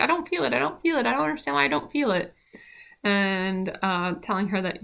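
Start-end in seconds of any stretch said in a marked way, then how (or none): 0.0-2.3 high, fast imitated wail; imitating crying. Client crying in triage after morphine, high mock wail. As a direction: mimic her tearful plea, pitched up. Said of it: "she kept crying, being like".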